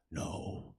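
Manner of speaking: soft, eerie whisper; creepy whispered reply. Retold or imitated. Stinky kid whispering to teacher, calm and creepy. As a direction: whisper it like a little serial killer.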